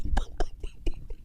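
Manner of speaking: silent wheezing giggle; whispered laugh. Real, not acted. Stifled laughter.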